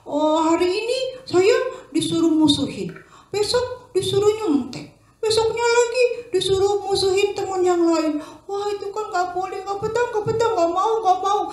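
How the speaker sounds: put-on high, loud, singsong wailing boy; distressed child. Boy wailing to his mother he can't stand school, high. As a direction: wail the complaint, chanting the end. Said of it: "katanya gini".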